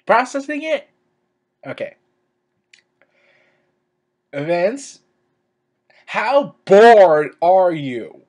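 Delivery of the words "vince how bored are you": slow, loud, drawn-out challenge; exasperated. Real, not acted. Fan berating Vince over the rumor, exasperated. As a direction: lean hard and long on "bored". Indignant question aimed at Vince.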